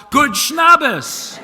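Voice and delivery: loud, high shouted greeting; yelled in chorus. Retold or imitated. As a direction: shout it across the room. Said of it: "and yell".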